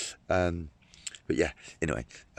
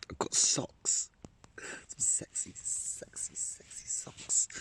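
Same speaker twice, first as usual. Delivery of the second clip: whispered, playfully seductive; hushed sexy-voice joke; mock-sultry whisper; 4 semitones higher, 4 dB quieter, much slower than usual. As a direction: whisper it like a secret turn-on, tongue in cheek. Mock-sultry talk about his socks.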